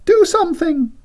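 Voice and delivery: high frantic cry. Acted. Siobhan calling from the bedroom, high frantic cry. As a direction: cry out for help from bed. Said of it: "A cry from the bedroom".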